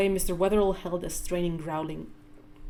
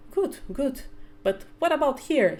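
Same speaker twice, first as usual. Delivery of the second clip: playful teacher; 7 semitones higher than usual. Acted. Mother teaching child, playful quiz.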